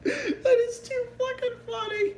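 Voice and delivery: high wheezing laughter through words; laughing so hard it hurts; crying laughing. Real, not acted. Watching funny video, crying laughing.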